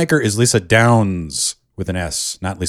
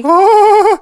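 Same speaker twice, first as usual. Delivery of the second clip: loud high laugh; 10 dB louder than usual. Real, not acted. Loud laugh after the shriek.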